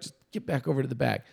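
put-on soft, fast, calm instruction to the kid; quiet and quick, calming down. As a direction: coach quietly telling his player to get back. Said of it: "I'm telling the kid".

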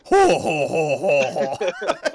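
imitated big booming "ho ho" laugh; hammed-up giant belly laugh; giant's laugh. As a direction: laugh like a giant, slow hos. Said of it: "start laughing like a giant".

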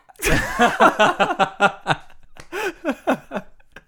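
long, high, loud laughing fit. Real, not acted. Podcaster after his own punchline, long loud laughter. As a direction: crack up hard at your own joke.

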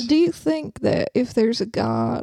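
slow Southern drawl; put-on slow Southern lady voice; Southern belle accent. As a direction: muse slowly like a Southern belle. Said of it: "You're not a Southern Belle".

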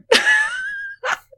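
very high squealing laugh; shrieking laugh. Real, not acted. Big laugh.

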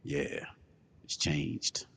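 soft, low seductive whisper; mock seductive hush; low sultry whisper. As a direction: breathe it like a come-on. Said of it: "Why your voice keep changing?".

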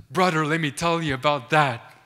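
loud, emphatic storyteller; eager local witness. Retold or imitated. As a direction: imitate an excited local announcing big news. Local man eagerly tells the story.

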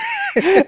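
shrill burst of laughter; real delighted squeal; high squeal laugh. Real, not acted. Laughing at friend's remark.

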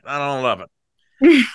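drawn-out, sing-song repeat; slow mock lament. Real, not acted. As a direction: stretch it out like a mock complaint.

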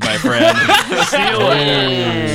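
laughing through a slow, high send-off. Real, not acted. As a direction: cackle while kicking someone off. Kicking a chat user off.

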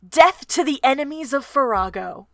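loud villain roar; roared war cry. Acted. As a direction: roar it to the army. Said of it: "threw back his head and roared".

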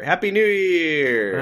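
high, drawn-out New Year shout; celebratory cheer. Pretend. Podcast host greeting listeners for the new year, celebratory cheer.

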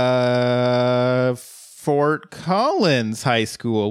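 stretched-out, very slow bluffing answer; stall, then drag out a guessed answer; stumped improvised answer. Acted. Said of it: "And I'd be like".

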